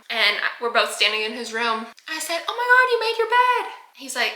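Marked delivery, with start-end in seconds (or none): 2.5-3.7 high, gushing surprise; amazed praise. Retold or imitated. Adult finding the boy's bed made, amazed. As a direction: exclaim it far above usual pitch.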